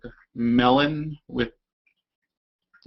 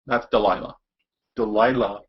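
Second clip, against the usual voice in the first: ordinary talk, nothing marked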